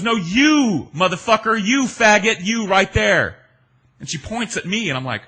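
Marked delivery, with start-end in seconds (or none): 0.0-3.4 high, loud retold yell; angry yell. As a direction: shout it at him, higher and louder. She points and yells at him.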